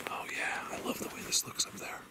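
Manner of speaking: soft, whispered admiration; hushed whisper. Real, not acted. Outdoor vlogger admiring how this looks up there, whispering. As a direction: whisper it under your breath. Hushed while filming the woods.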